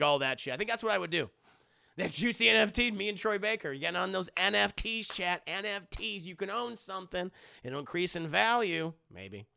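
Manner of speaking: ordinary delivery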